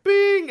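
high, loud, ringing "bing"; imitated train chime. Man mimicking the train's stop bell, imitated chime. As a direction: sing out a mock door chime.

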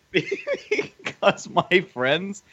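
slow, high, laugh-broken words; real laughter breaking the phrase. Real, not acted. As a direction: push the words out through giggles. Breaks up laughing mid-sentence.

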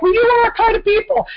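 high, excited imitation of the women; gushing fan voice. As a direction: squeal it like delighted fans. Women quoted again, high voice.

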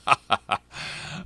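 embarrassed laugh. Real, not acted. Laughs at own bad accent.

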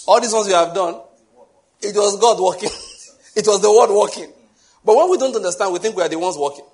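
preaching emphasis. Real, not acted. Preacher raising his voice to the church about God's work. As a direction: drive the point home to the congregation.

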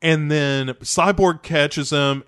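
ordinary delivery

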